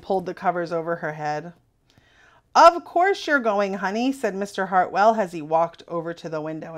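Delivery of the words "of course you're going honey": cheerful father. A narrator's reading.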